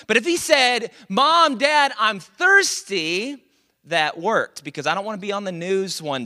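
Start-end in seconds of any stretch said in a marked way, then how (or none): 1.1-3.4 put-on raised, drawn-out kid's call; loud, high plea to parents; child calling parents. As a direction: imitate a kid calling out from bed.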